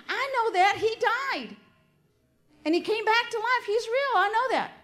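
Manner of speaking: high, loud, exasperated insistence; exasperated retort. Retold or imitated. Wife snapping back at mother-in-law, high exasperated insistence. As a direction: throw it back at her, fed up. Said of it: "I'd say".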